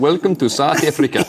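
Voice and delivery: mimicked South African accent. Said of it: "my first concept of the South African accent".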